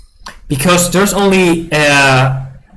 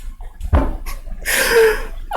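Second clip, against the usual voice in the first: high, quiet wheezing laugh; helpless laughter; 7 dB quieter than usual. Real, not acted. Player cracking up at a crushing win, helpless laughter. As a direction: lose it completely, laughing almost without voice. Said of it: "I'm laughing".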